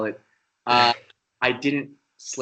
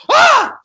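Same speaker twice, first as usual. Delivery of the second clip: sudden high, loud shriek; mimicking the actresses' startled scream; 12 dB louder than usual. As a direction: shriek in shock. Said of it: "They're like".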